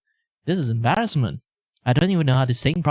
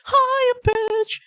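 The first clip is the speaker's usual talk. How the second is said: very high voice. Shown as a demonstration. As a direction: leap far up for the one word. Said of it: "change my voice into higher".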